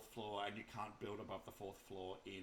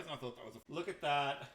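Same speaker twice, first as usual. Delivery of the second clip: ordinary talk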